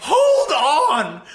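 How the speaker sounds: loud, high-pitched retold outburst; exasperated shout. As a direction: yell it to halt the whole conversation.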